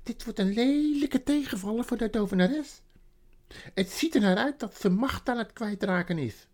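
nearly dancing with joy as you speak; joyful beaver. Acted. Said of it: "die bijna stond te dansen van blijdschap".

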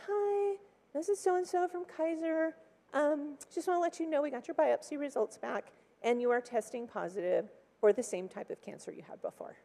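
a hint of a polite clinic caller; nurse on the phone. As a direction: lightly voice the caller from Kaiser. Nurse voiced on phone call.

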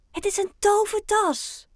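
high, loud exclamation; excited girl calling out. A narrator's reading. Girl to the children about a magic bag, excited cry. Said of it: "roept het meisje uit het busje".

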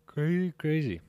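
words stretched through a yawn. Real, not acted. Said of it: "excuse me for the yawn".

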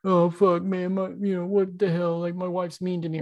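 whiny inner voice. Retold or imitated. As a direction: whine like a self-pitying brat. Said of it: "that selfish, whiny bitch inside your head".

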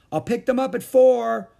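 high, loud, cheery call; called gaily. A narrator's reading. Neighbour calling from her car, gaily. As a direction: sing it out brightly as you leave. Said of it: "she called gaily".

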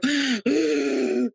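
quick startled intake of breath; a loud gasp of surprise at the question; surprised gasp. Retold or imitated. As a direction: gasp as if caught off guard. Surprised by a special call.

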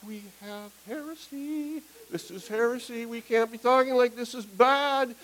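a slowed, high-pitched protest, half mock panic; alarmed inner cry. Pastor recalls his inner alarm hearing heresy, high mock outcry.

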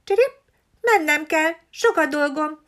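high, hurried sparrow voice; little sparrow bowing out; sparrow excusing itself. A narrator's reading. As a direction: make a quick polite excuse.

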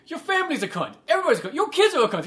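high-pitched acted quarrel; mock insulting. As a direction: hurl escalating insults as an imitated hothead.

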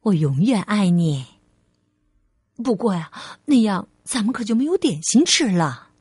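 low, tender motherly voice; loving mother, reassuring. Acted. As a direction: reassure your little one sincerely.

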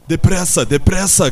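high, very loud cry of alarm; urgent shout. A narrator's reading. Benjamin calls the animals to hurry, shouting urgently.

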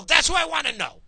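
yelling in real outrage at the government; real furious rant. Real, not acted. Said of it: "Yeah, I'm worked up".

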